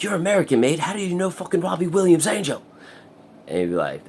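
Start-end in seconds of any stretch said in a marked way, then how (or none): 0.0-2.6 put-on high, loud English accent; English accent, high and excited; mock English accent. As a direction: play an amazed English bloke. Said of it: "They're like".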